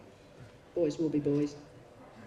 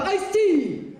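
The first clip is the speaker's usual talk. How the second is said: high, triumphant stage announcement; announcer's cry; 13 semitones higher than usual. Acted. Announcing main man leaping onto the stage, triumphant. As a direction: hail the star in a soaring cry.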